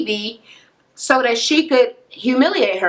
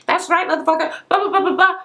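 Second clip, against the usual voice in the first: going off; 9 semitones higher, faster than usual. Retold or imitated. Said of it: "and she is going off".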